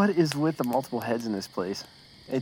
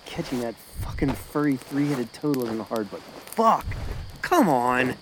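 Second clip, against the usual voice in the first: ordinary delivery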